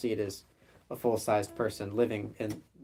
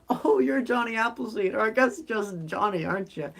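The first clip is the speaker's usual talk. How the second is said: much higher-pitched little gnome; 10 semitones higher than usual. Acted. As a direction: pipe it up high as a gnome recognizing someone. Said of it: "the gnome goes up to him and says".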